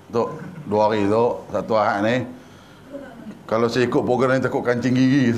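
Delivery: low, sheepish pleading with a boss; meek excuse. Acted. Newlywed bargains with Datuk to skip the programme, meek and low. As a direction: make a feeble excuse in a dropped voice.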